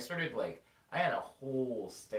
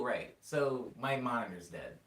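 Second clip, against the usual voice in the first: usual speaking voice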